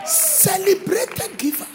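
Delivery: high and slow; drawn-out exclaim. Real, not acted. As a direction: stretch it out high. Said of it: "repeated slow high".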